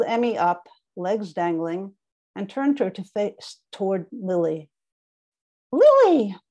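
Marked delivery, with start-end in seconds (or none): 5.7-6.4 high squeaky stuffed-animal voice; squeaky emu toy voice. Acted. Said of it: "I said in a squeaky voice".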